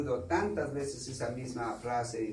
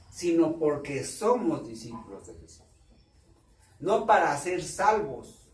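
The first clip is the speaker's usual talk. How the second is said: preaching at full force; 4 semitones higher, 9 dB louder than usual. Acted. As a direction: drive it home to the congregation. Preaching climax. (predicación a todo pulmón, más aguda y fuerte)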